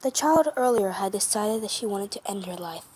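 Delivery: ordinary delivery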